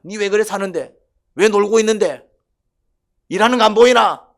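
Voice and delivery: high, loud angry yell at a lazy person; angry scolding. Retold or imitated. Preacher voicing a hard worker yelling at an idler, loud and furious. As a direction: bark it like an exhausted worker fed up.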